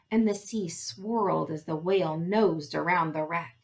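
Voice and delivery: usual speaking voice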